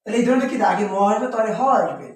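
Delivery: usual speaking voice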